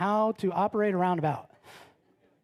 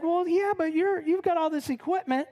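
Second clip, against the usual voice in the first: higher-pitched mock complaint; indignant protest; 8 semitones higher than usual. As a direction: protest the unfairness in a raised pitch. Struggling climber protests back.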